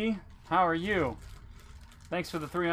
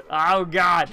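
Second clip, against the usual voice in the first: panicking gamer; 3 semitones higher, 10 dB louder than usual. Real, not acted. Still reeling from scare.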